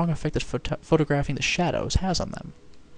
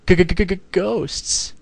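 stammering fright, higher and louder; scared horror game streamer; 5 semitones higher, 5 dB louder than usual. Real, not acted. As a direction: stutter out the word in fear. Ghost appears, stuttering in fright.

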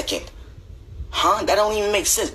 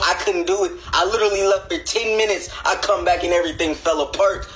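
ordinary talk, nothing marked

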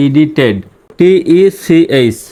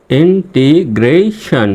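Integration slowly in separate syllables; broken into syllables; 2 semitones higher, much slower than usual. Shown as a demonstration. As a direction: draw integration out piece by piece, ending on "shon". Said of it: "এভাবে ভেঙে ভেঙে উচ্চারণ করবেন".

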